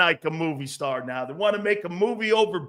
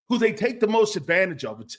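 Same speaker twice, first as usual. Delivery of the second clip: normal talking voice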